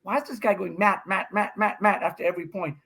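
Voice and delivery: imitating Riley, laughing through it; laughing mock complaint. Imitating an irritated opponent, laughing. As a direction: mimic a baffled opponent while chuckling. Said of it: "Riley, on the other hand, is like".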